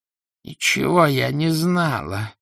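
slow, high-pitched wheeze; laboured wheeze. A narrator's reading. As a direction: force the words out with difficulty. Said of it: "с трудом просипела Моргунова".

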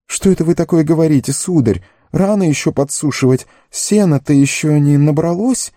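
high, protesting innocence; flustered gardener. A narrator's reading. Sam feigns innocence before Gandalf, high and flustered. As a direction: play dumb in a high, hurried voice.

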